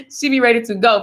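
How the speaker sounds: ordinary delivery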